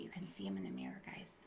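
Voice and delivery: quick unvoiced whisper. Real, not acted. Telling the kids a secret about the picture, whispered. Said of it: "whispered aside".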